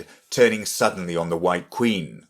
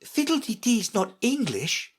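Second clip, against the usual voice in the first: high-voiced, understated seriousness; grave. A narrator's reading. Alice answering the Red Queen, grave. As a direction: correct her earnestly in a high voice. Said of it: "Alice replied gravely".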